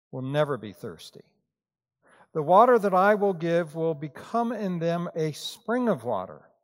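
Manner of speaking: scripture reading. Acted. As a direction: read the passage solemnly to the congregation. Reads scripture passage aloud.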